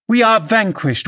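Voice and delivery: high, theatrical wail of defeat; mock despair. A narrator's reading. Wife taunting her cowed husband, mock despair. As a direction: cry it out in mocking surrender.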